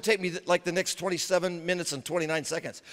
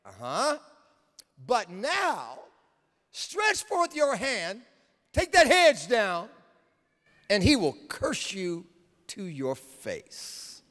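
sneering Satan; 6 semitones higher, slower than usual. Acted. Satan voiced accusing God.

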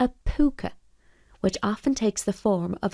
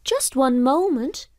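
calling out; 6 semitones higher, 3 dB louder than usual. Acted. Boy calls after pooka.

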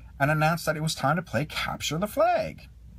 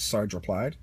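ordinary delivery

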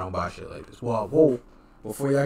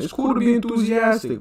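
excited; 12 semitones higher, 7 dB louder than usual. Real, not acted. Mocking enthusiasm.

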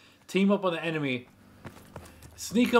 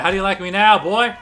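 taunting shout; 2 semitones higher, 7 dB louder than usual. Real, not acted. Taunting after a kill.

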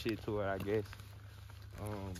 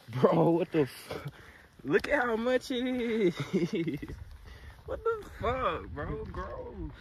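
disgusted surprise; 8 semitones higher, 4 dB louder, slower than usual. Real, not acted. Spots horse dung on road.

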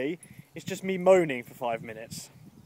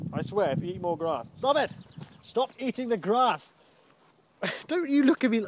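sharp, higher-pitched telling-off; scolding the dog; 6 semitones higher than usual. Real, not acted. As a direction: order the dog to stop. Scolding the dog for eating grass.

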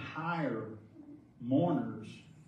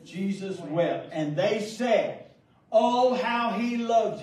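higher, louder, very slow build; preacher's slow high swell; slow emphatic preaching; 8 semitones higher, 7 dB louder, much slower than usual. Acted. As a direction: drag it out and lift each word. Climax of the retold story.

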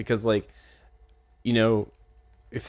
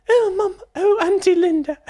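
put-on much higher and louder, a falsetto girl; teen girl voice; 8 dB louder than usual. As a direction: squeak it out like a fifteen-year-old girl. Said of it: "the main character's voice".

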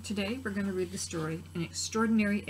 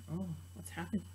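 much softer, anxious murmur; hushed worried gasp; 3 semitones lower, 10 dB quieter than usual. Acted. Frightened frogs watching the pool, hushed worry. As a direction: fret under your breath.

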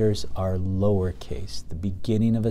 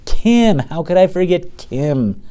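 much higher and louder, surprised; light up as the name comes back; sudden recall; 10 semitones higher, 9 dB louder, slower than usual. Real, not acted. Teacher to class, suddenly remembering Kim.

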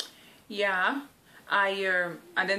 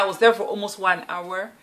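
normal talking voice

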